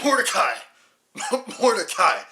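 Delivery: loud laughing yell of the name; laughing shout. Acted. Mocking the film title, laughing.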